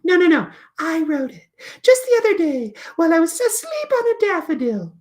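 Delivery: very high little puppet voice; tiny, high creature telling a tale; Flitter. Acted. Puppet tells teacher about her poem, very high. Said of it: "Flitter eventually teaches us".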